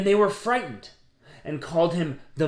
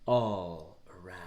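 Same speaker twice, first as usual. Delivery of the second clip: breathy whispered ending; 10 semitones lower, 6 dB quieter than usual. A narrator's reading. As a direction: drop to a whisper on all around.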